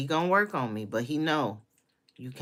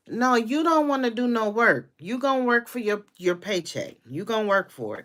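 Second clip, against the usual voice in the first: raised retort; 8 semitones higher, 7 dB louder than usual. Acted.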